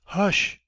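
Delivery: high, firm hush sent through the flat; a warning hush carried across the rooms; loud hush. Acted. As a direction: hush the whole household. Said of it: "floats over the flat".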